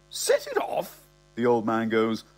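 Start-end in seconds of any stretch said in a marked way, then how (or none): 0.1-1.0 an incredulous squeak, far higher; shocked old man. Acted. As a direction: echo it in a startled high yelp.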